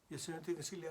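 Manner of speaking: ordinary talk, nothing marked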